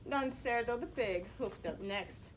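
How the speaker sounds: usual speaking voice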